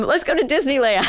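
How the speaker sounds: high, chirpy mock parent offering a treat; cheery parent. Therapist mimics parent cheerfully offering a sad kid yogurt.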